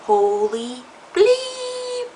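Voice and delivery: high, drawn-out stunned gasp of disbelief; shocked exclamation. Retold or imitated. God brother sees her grown up, stretched high shocked. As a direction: stretch it out high like you can't believe your eyes.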